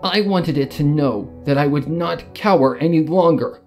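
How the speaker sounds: ordinary talk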